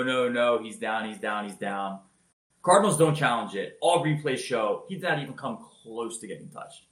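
ordinary delivery

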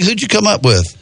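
ordinary talk, nothing marked

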